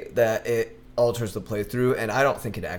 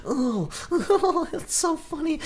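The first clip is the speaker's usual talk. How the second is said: very high, cackling creepy creature voice; hammed-up creepy cackling creature; creepy laughing voice; 13 semitones higher, 3 dB louder, slower than usual. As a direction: giggle it like a creepy Gollum thing. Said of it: "creepy ass voice laughing behind you".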